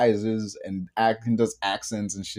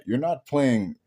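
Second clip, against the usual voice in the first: ordinary talk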